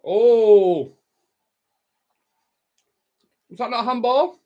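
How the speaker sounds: loud, high cry of disbelief; shocked appeal for a handball; outraged surprise. Real, not acted. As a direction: yell at the ref's decision. Reacting to a match incident.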